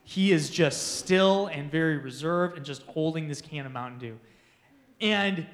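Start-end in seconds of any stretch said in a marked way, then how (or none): none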